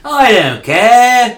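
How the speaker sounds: mock coward whine. Echoing the coward quote.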